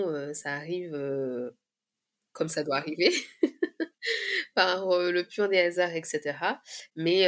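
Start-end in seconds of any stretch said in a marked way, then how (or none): 3.2-4.5 high, light laugh; short burst of amused laughter; high giggle after the remark. Real, not acted. Amused aside on fate.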